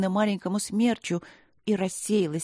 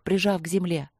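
plain reading voice

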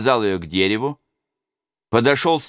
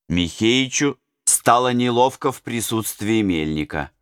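ordinary delivery, nothing marked

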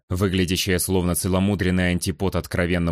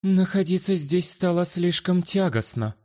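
sour noblewoman; 10 semitones higher than usual. Acted. Luana leaving, sour.

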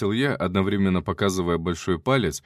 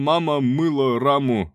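mock accent; 4 semitones higher, 4 dB louder than usual. Mocking fake Chinese.

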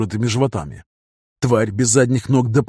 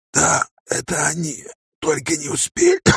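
resigned dying voice; 4 semitones higher than usual. A narrator's reading. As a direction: let it go with a weak breath. Умирающий ангел. (тихо, обречённо)

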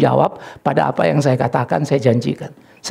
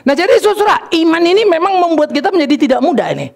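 khotbah berapi-api; 13 semitones higher than usual. Acted. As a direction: preach it out with raised pitch. Kembali berkhotbah bernada tinggi. (berkhotbah lantang bernada tinggi)

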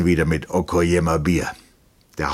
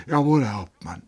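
raised, mechanical military reply; 6 semitones higher than usual. A narrator's reading. Lieutenant obeys the captain's order, mechanically. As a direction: answer by rote, like a soldier. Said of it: "sagte Trotta mechanisch".

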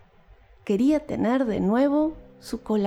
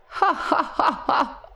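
weaver laughing; 5 semitones higher, slower than usual. A narrator's reading. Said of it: "rió el tejedor".

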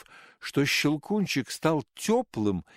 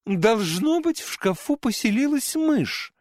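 high, louder exclaiming mother; 10 semitones higher, 3 dB louder than usual. A narrator's reading. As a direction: exclaim it in surprise. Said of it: "воскликнула мать наутро".

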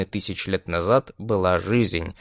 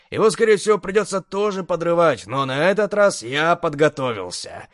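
higher, pleased soldier explaining; Dred's confident voice; 6 semitones higher than usual. A narrator's reading. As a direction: explain it like a proud sapper. Dred explains the plan.

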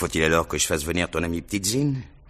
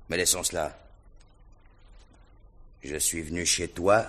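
usual speaking voice

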